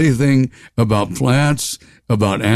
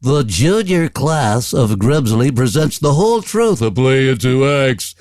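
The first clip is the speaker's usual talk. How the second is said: school PA announcement; 3 semitones higher than usual. Retold or imitated. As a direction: imitate a crackly school speaker announcement. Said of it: "And then you hear".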